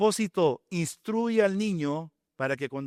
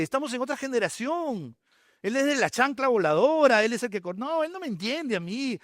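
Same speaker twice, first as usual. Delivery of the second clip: put-on higher, fast complaining kid's voice; mock whiny teenager; 5 semitones higher than usual. Pastor mimicking a rebellious son griping about his father, high whiny voice. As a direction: whine like a teen misunderstood by dad. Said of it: "yo soy hijo".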